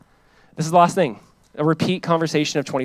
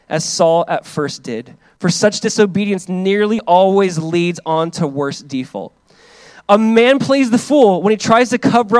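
slow, louder proclaiming of each line; emphatic reading of a quote; 3 semitones higher, 5 dB louder, slower than usual. Acted. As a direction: hammer each clause home. Reads Baxter quote emphatically.

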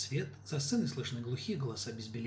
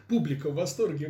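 ordinary delivery

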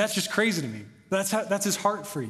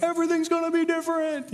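put-on much higher, starry-eyed teenage promise; mock teen gush; 11 semitones higher than usual. Preacher mimicking his teen self crying at worship, high gushing. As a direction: gush like an emotional high schooler.

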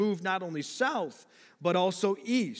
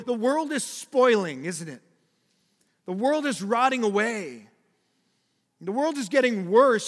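rising warning; 6 semitones higher, 7 dB louder than usual. Acted. Preacher warns of a decaying world.

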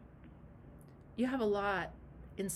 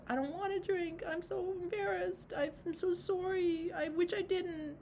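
high-pitched, acted tearful apology; mock-weepy, high little voice; crying; 9 semitones higher than usual. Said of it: "the alcoholic starts crying and says".

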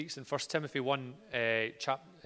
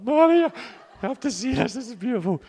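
mock bawling; 12 semitones higher, 13 dB louder than usual. Preacher mocking himself crying at Modern Family, hammed-up wail. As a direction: ham up blubbering at the telly. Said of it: "I'm there bawling like".

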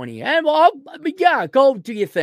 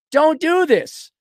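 alarmed parent; 8 semitones higher, 4 dB louder, slower than usual. Retold or imitated. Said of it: "some parent says".

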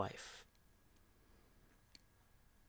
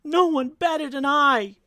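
high, loud desperate protest; crying out; 14 semitones higher, 10 dB louder than usual. Acted. Brooks justifies himself before everyone, crying out. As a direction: protest at full voice, far up in pitch. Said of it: "cried Brooks".

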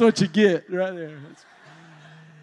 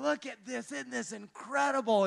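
high, soft amazed hush; hushed awe; 5 semitones higher, 8 dB quieter than usual. Retold or imitated. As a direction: breathe it out in quiet wonder, higher. Husband retells his overwhelmed awe.

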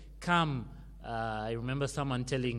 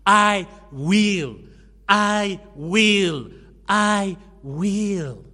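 "I will" shouted three times, much louder and higher; emphatic I will; 5 semitones higher, 14 dB louder, much slower than usual. Shown as a demonstration. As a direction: hammer each "I will" like a vow. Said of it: "how emphatic God is by saying".

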